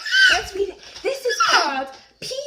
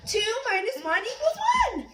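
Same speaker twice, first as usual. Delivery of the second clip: ordinary delivery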